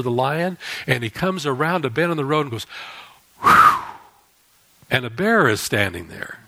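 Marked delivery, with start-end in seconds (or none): 3.4-3.8 relieved whew. Acted. Man relieved after escaping.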